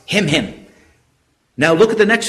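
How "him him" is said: "Him, him" as a quick doubled beat; "Him, him", compact and falling; emphatic repetition. Shown as a demonstration. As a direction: say "him" twice in quick succession, both stressed. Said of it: "it appears twice".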